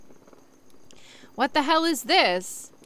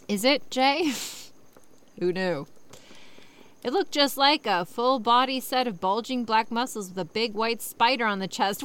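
normal talking voice

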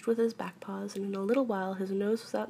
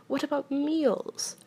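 slow, higher-pitched forlorn question; gloomy; 7 semitones higher, 3 dB louder, slower than usual. A narrator's reading. Pooh asks about meals while stuck, gloomily. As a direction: draw it out in dismay. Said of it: "said Pooh glo-, gloomily".

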